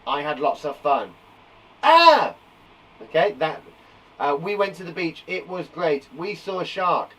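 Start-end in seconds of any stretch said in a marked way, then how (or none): 1.8-2.3 high, loud "ah" of surprise; startled yell. Shown as a demonstration. Teacher showing what surprise sounds like, startled yell. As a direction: cry out as if shocked. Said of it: "It's come as a surprise".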